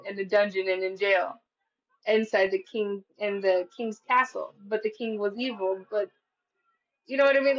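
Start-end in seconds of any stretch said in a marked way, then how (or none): none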